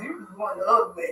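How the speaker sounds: lightly voiced old grandma. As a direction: tease like a grandma. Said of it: "that's in my grandma voice".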